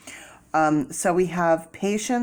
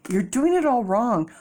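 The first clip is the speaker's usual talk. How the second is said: lightly voiced tarot critics scolding; mocking critics; 5 semitones higher than usual. Tarot critics scolding the reader, lightly mocked. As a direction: imitate the scolding tarot people. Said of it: "People in tarot are like".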